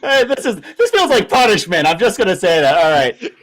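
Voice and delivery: loud, high, laughing protest; loud sharp voice. Real, not acted. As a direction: protest loudly, half laughing. Protesting being asked to sign off.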